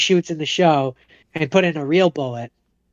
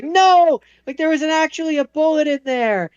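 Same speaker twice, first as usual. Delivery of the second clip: mock frantic shout; frantic yell; 10 semitones higher than usual. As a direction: burst in shouting to stop it. [name] bursts in retold.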